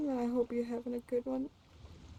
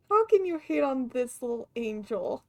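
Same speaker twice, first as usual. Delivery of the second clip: ordinary talk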